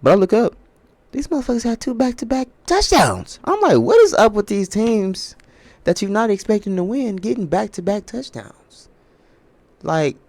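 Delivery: high, loud incredulous rant; exasperated disbelief. Real, not acted. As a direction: voice jumps up in disbelief at the teams. Ranting in disbelief at the game.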